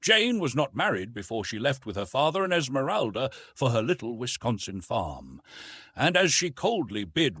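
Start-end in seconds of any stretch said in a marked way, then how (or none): none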